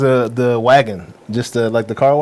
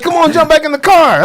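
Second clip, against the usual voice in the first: high, loud mock urging; acted urging; 14 semitones higher, 5 dB louder than usual. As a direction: call him back over the laughter, playfully. Acts out driver urging him back.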